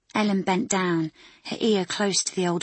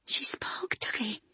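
whisper; 6 semitones higher, 4 dB quieter, slower than usual. Acted. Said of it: "her own voice barely a whisper".

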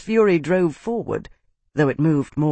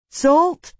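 high, raised shout, rising at the end; 12 semitones higher, 4 dB louder than usual. A narrator's reading. Slave shouts to Tavi through the storm, high shout. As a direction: call it out over a storm. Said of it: "she shouted through the storm".

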